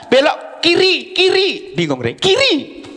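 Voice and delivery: mock direction giver. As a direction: bark directions half in jest. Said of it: "Kan bisa".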